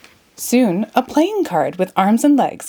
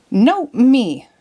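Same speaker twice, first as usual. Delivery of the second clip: insisting; 5 semitones higher, 4 dB louder than usual. A narrator's reading. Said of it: "Tweedledee insisted".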